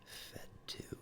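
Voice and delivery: whispering. Real, not acted. Reading a name under breath.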